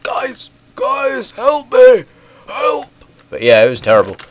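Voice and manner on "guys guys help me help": high, strained cry for help; mock plea for help. Player imitates himself sick on Xbox, mock pleading. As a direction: act out a helpless, sick plea over chat. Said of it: "I was like".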